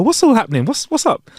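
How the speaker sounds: high, baffled what's happening; startled high question; confused what?. Retold or imitated. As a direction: ask it high and lost. Confused reply to friend in story.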